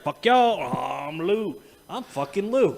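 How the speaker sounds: cocky mock pet voice; voicing Lou. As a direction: play the animal who owns the chair. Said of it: "Jumped up in the chair like".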